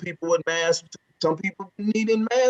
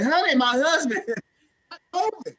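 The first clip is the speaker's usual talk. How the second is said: imitating mama; 6 semitones higher than usual. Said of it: "She was like".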